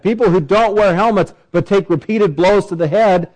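usual speaking voice

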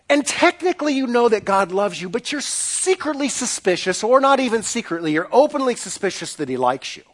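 normal talking voice

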